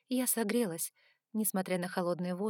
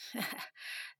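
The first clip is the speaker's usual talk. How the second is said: soft, breathy smirking laugh; quick quiet huff of amusement; short chuckle; 4 semitones higher, 8 dB quieter than usual. A narrator's reading. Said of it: "Он усмехнулся".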